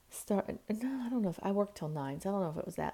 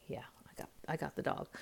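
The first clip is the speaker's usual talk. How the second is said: hushed reply; 7 dB quieter, faster than usual. Retold or imitated. Daughter quietly insists she's fine.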